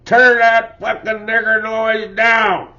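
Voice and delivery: screamed high and loud, slow and raging; retold furious scream. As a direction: roar it like an enraged father. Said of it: "My old man screamed".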